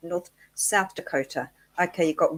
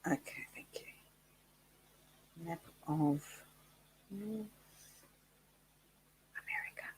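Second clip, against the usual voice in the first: whispering; 15 dB quieter, much slower than usual. Real, not acted. Muttering to herself while searching.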